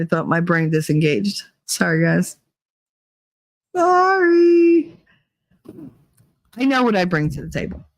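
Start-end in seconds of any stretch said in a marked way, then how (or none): none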